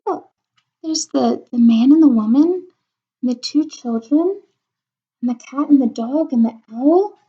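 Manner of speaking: usual speaking voice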